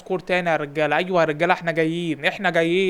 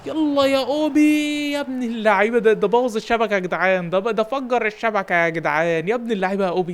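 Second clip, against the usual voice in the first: goal cheer; 3 semitones higher, 5 dB louder than usual. Real, not acted. Coach hails Obi's net-busting goal loudly. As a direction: shout it out drawn long.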